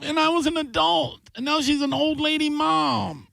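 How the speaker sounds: very high, stretched-out wail; appalled disbelief. Real, not acted. Host realizing actress plays old mom now, high wailing disbelief. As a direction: wail it as if time has betrayed you.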